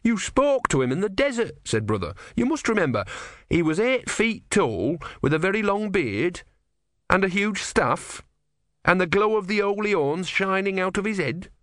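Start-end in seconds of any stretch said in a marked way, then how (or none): 0.0-1.5 high, earnest insistence; insistent. A narrator's reading. Disciple reminding his forgetful god, high and insistent. 2.4-11.5 high, earnest recollection; insistent. A narrator's reading. Disciple describing a prophet to his forgetful god, high and insistent.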